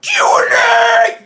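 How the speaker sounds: high, drawn-out scream; excited scream. Real, not acted. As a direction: scream the show open at full blast. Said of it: "Never too early to scream".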